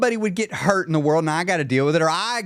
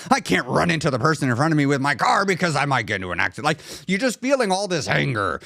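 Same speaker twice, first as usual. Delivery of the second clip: usual speaking voice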